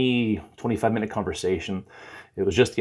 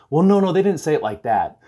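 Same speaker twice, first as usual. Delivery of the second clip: higher mock backpedal; higher-pitched retold objection; retold protest; 8 semitones higher, 4 dB louder than usual. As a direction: imitate a hasty correction, pitched up. Retold man hastily correcting himself.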